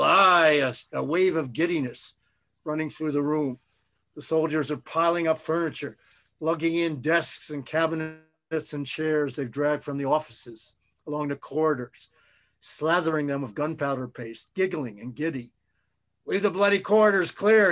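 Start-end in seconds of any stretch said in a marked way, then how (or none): 0.0-0.6 loud, drawn-out shout of assent; crowd's yell. A narrator's reading. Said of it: "laugh and yell". 16.3-17.7 loud, high military command; shouted order. A narrator's reading. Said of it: "he hears Scott shout".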